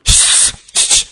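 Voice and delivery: sharp repeated shushing; hushing. Acted. Embarrassed father quieting his child, hissed shush.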